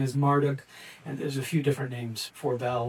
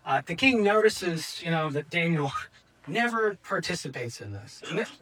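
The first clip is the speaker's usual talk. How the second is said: ordinary delivery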